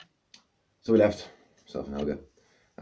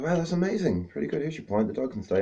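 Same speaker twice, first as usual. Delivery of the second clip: high, louder impressed barman voice; 12 semitones higher, 4 dB louder than usual. Retold or imitated. Barman impressed by the dog, high delighted voice. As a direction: play the barman won over and delighted.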